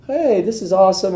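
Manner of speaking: high gleeful exclaim; delighted. Retold or imitated. Teen on the couch with arm around her, high gleeful. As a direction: savour the moment.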